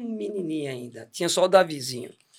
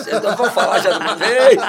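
laughing; 3 semitones higher, 8 dB louder than usual. Real, not acted. Laughing while confessing.

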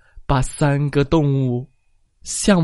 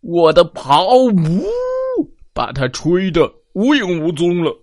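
roaring elephant; 5 semitones higher than usual. Acted. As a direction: bellow it out. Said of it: "大象大吼道".

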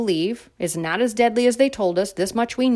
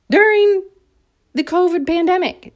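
incredulous laugh; 10 semitones higher, 6 dB louder than usual. Real, not acted.

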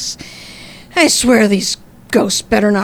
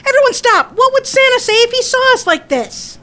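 shouting; 13 semitones higher than usual. Acted. Joanna breaks up the brawl.

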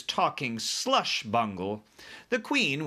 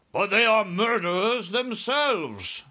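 high, loud, slowed protest; protesting cry; 10 semitones higher, 6 dB louder, slower than usual. A narrator's reading. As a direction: cry out in protest as army chief. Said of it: "cried the head of the army".

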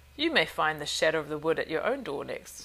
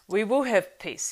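firm and clear, louder and higher; 4 semitones higher, 7 dB louder than usual. A narrator's reading. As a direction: ring out clearly and firmly. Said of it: "he said now in a clear voice".

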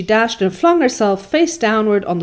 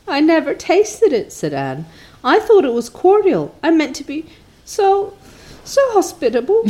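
put-on distressed young Anne; 9 semitones higher than usual. A narrator's reading. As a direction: pour out the grief like a wronged child. Anne's voice, protesting.

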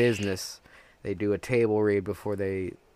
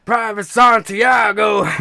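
loud, high, drawn-out mock cry; hammed-up yell; 11 semitones higher, 11 dB louder, slower than usual. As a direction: bellow the name slowly, overdramatic. Hammy cry of character's name.

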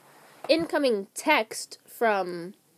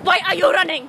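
shouting; 6 semitones higher, 8 dB louder, faster than usual. Real, not acted. Friend running after recording.

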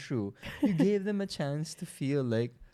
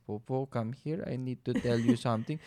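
softer, halting and unsure; awkward voice; 3 semitones lower, 7 dB quieter than usual. Retold or imitated. Mimicking his fiancée's awkward call, softer and unsure. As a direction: imitate her nervous, awkward summons. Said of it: "sobrang awkward ng voice niya".

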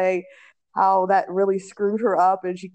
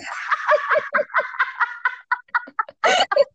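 high laughter; 12 semitones higher than usual. Real, not acted. Friend's name mix-up.

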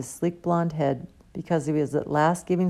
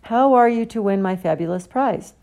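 higher and louder, teasing challenge; brisk showman; 5 semitones higher, 6 dB louder than usual. A narrator's reading. Slughorn challenges the pupils, brisk and showy. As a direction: dangle the prize before them. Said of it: "suddenly brisk".

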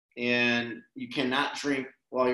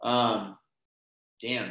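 choked up; 5 semitones lower, 3 dB quieter, slower than usual. Real, not acted. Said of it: "I get choked up thinking about it".

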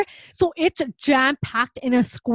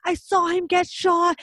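panicked lady; 6 semitones higher, slower than usual. Retold or imitated. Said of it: "she's just like".

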